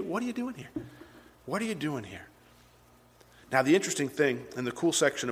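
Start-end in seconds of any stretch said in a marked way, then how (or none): 0.0-2.3 soft repeated question; gentle. Acted. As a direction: ask it hushed, letting it sink in. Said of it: "The Lord would say the same thing".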